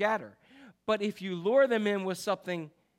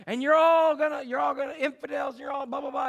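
loud and higher, hammering each "you're all"; mock preacher yell; 7 semitones higher, 4 dB louder than usual. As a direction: yell at the students like a campus preacher. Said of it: "he would just yell at people".

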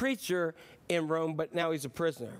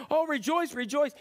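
high, sing-song oh rejoice; breezy carefree saint; sing-song mock cheer, no care in the world; cheery stained-glass Paul; 6 semitones higher than usual. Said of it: "stained glass Paul and he's saying".

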